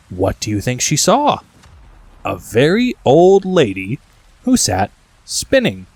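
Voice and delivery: usual speaking voice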